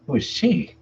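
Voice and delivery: put-on young daughter's voice. Daughter questions her father.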